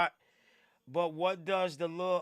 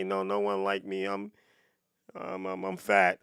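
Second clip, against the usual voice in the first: much lower, mopey sad-sack voice; mock pitiful guy; 9 semitones lower than usual. Mocking a guy who fishes for girls' sympathy, mopey low voice. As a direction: whine like a lonely guy fishing for sympathy. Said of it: "That used to work back in the day".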